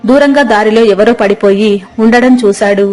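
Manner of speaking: plain reading voice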